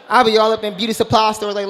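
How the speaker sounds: usual speaking voice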